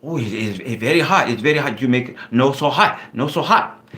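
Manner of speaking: Thai restaurant worker's broken-English warning, slightly Chinese-sounding; mock Thai accent, halting and cautioning; imitated Thai accent. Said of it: "I don't know why I sound Chinese".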